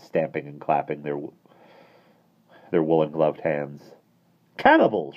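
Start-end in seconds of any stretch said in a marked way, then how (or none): 4.6-5.2 fierce. A narrator's reading. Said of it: "she said fiercely".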